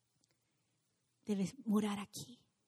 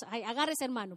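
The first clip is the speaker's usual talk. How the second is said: normal talking voice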